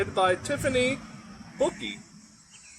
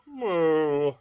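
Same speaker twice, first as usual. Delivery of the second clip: complaining moo; 3 semitones lower than usual. Acted. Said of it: "The cow complained".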